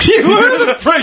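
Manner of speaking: high-pitched, laughing squeal of amusement; laughing disbelief. Real, not acted. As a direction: crack up while saying it, voice shooting up. Cracking up at the revelation.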